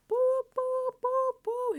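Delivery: high, loud boops; siren imitation. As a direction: imitate an alarm siren in repeated high hoots. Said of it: "Here come the sirens".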